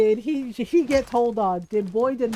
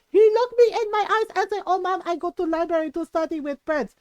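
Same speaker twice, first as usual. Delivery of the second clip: high and loud, indignant mother; imitating mom's furious outburst; 5 semitones higher, 4 dB louder than usual. Mom telling her eldest how the son lied to her face, outraged. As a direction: spit out the betrayal.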